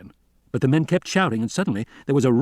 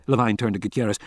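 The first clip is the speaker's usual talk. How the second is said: usual speaking voice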